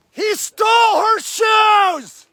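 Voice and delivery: high, slow cry of outrage; drawn-out indignant cry. Real, not acted. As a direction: stretch out stole in outrage. Repeats the outcry, higher and slow.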